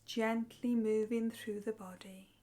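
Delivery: soft, unhurried meditation voice with long pauses; guided meditation. Acted. Meditation teacher leading listeners through breathing and relaxation, soft guided meditation.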